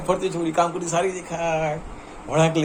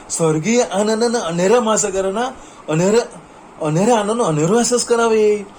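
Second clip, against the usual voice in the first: swelling louder and higher with delight; rising rapture; 3 semitones higher, 3 dB louder than usual. Real, not acted. Praising heavenly bliss to listener, building excited rapture. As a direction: let the joy build as you go.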